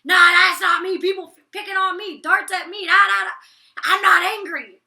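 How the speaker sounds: loud, high imitation of a fuming man; mock angry denial. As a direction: shout that you're not angry. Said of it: "But when it gets to him".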